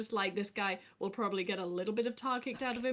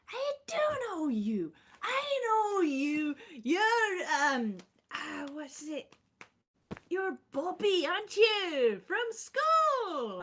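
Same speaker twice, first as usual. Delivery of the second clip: overjoyed reunion; 9 semitones higher, slower than usual. Acted. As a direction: gush like you've found a long-lost friend. Engineer greets Higgs like old friend.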